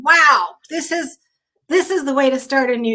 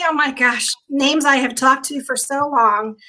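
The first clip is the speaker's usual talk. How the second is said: normal talking voice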